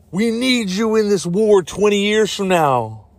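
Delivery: high, melodramatic recruiting voice; hammed-up urgent plea. Time traveler recruiting Chris Pratt for the war, hammed-up plea. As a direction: plead like a bad movie soldier.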